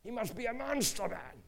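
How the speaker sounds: acting out an outraged unbeliever; incredulous outrage. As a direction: protest in acted indignation. Boss's accusation against God, retold.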